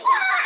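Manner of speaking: short high-pitched shriek; loud falsetto yelp, mimicking her; imitated scream.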